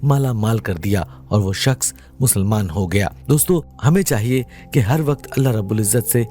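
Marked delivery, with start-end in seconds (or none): none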